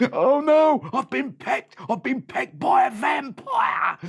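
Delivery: high loud wailing shout; terrified bandit. Acted. Pecked bandit wails that a vampire got him. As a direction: wail it in fright.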